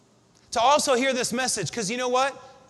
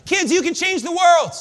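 rallying call; 6 semitones higher, 6 dB louder than usual. Real, not acted. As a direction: fire them up. Rallying the children in the room.

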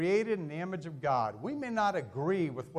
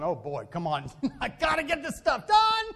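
busy dad, laughing; 6 semitones higher, 3 dB louder than usual. Retold or imitated. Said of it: "the dad's going".

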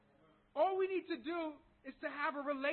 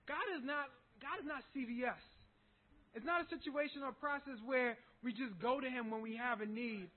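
ordinary talk, nothing marked

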